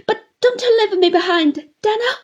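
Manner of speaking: put-on high, loud, crying old widow begging; tearful pleading. A narrator's reading. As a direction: offer herself as his servant through tears. Mrs Gummidge begs to come along.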